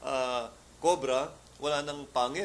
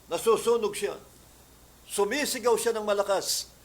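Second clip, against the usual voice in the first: loud, higher preaching climax; fiery preaching; 5 semitones higher, 6 dB louder than usual. Acted. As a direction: preach it out, building to full force. Preacher retells exorcism, building.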